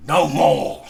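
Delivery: sudden loud outcry, pushing the ghost away; anguished cry. A narrator's reading. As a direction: shout it out, begging it to stop. Scrooge cries out to ghost.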